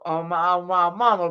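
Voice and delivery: normal talking voice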